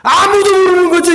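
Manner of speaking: normal talking voice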